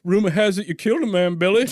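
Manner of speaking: high, loud mock-western line reading; acted movie questioner. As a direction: quote the film in a hammed-up voice. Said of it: "He's like".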